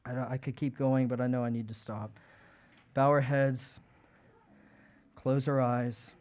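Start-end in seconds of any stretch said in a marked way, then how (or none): 2.9-6.1 hushed, earnest closing invitation; altar call. Real, not acted. Closing altar call over music.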